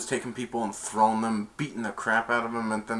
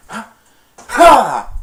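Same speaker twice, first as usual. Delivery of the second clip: karate yells; 12 semitones higher, 17 dB louder than usual. Retold or imitated. Martial arts strike cries.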